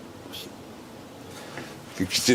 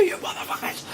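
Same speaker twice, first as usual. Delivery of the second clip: out of breath; 4 dB quieter, faster than usual. Retold or imitated. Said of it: "He just runs out of breath".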